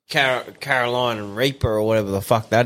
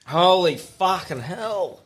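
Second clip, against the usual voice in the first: slow, high, stunned curse; stunned exclamation; 5 semitones higher, much slower than usual. Real, not acted. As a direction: react to the burn, drawn out. Tasting very hot sauce.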